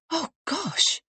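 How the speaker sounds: a high, gasped oh gosh; gasping surprise. Acted. Helen hears of a death, gasping surprise. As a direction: Helen catching her breath in shock. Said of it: "Helen gasped".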